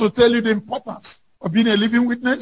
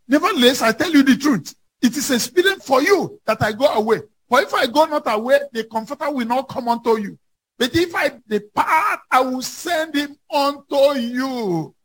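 a little higher, slowing toward the end; fervent scripture quote; 4 semitones higher than usual. Acted. Pastor quoting Jesus to the congregation, earnest and rising. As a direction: lift the verse with growing weight.

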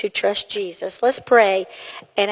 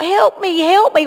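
higher, louder demonstrated plea; crying out; 7 semitones higher, 4 dB louder than usual. Acted. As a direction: act out calling for help. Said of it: "when you cry out".

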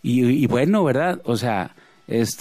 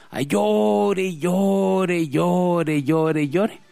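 lightly voiced wailing child, fading out; a hint of a baby whining "llore" over and over; crying baby; 3 semitones higher, much slower than usual. Priest imitating the crying baby at mass, mild wail.

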